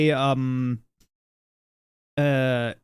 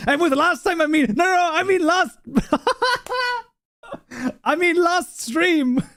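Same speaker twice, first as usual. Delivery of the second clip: very high, squealing through laughter; real helpless laughter; 12 semitones higher than usual. Real, not acted. Streamer correcting himself to teasing chat, laughing uncontrollably. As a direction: protest while cracking up, voice shooting up.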